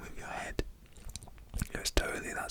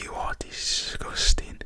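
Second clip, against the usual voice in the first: mock disgust; 9 dB louder, slower than usual. Man tells off drunk woman.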